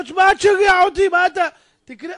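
high, loud mock exasperation; exasperated outburst. Pauper tells lotto nagger to stop, exasperated shout. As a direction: push back high and loud.

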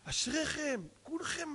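high, rising flattery; fawning praise. Acted. Rabbi flattering Yemenite mourners, high fawning praise. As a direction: lift it up into helpless admiration. Flattering the congregation in acted speech.

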